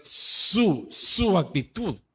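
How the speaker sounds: SU said twice, long and drawn out; slow, weighty stress on "su, su actitud"; emphatic stress. Shown as a demonstration. Preacher stresses that God watches your attitude, leaning on "su". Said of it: "le repito y le recalco".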